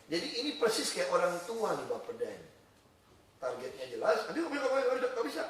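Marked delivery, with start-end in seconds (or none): 4.3-5.4 high-pitched objecting; mock fretful refusal. Imitating a parent-like agency refusing, high mock fretting. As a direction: fuss like an over-cautious parent.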